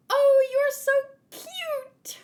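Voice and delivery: very high and loud, gushing; fawning squeal. Acted. A character gushing over the cute princess, shrill and loud. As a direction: squeal over something adorable.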